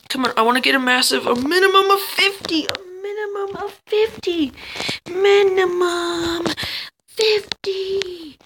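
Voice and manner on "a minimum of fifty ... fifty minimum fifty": high, increasingly drawn-out whine; insistent plea. Real, not acted. Gamer wanting minimum fifty mass, high insistent plea. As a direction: stretch it out high, pleading for it. Pleading for a score.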